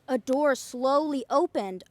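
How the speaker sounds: ordinary delivery, nothing marked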